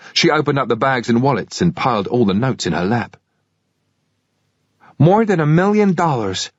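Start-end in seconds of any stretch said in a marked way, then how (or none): 5.0-6.5 put-on high, naive girl voice. A narrator's reading. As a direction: announce it proudly and naively. Girl's naive count, high.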